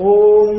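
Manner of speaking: ఓం held long and loud on one steady note; ఓం as one prolonged, resonant syllable; long drawn-out Om. Shown as a demonstration. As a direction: sustain the vowel of ఓం, humming into its close. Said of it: "దానిలో ఉన్న దీర్ఘం ఉందే".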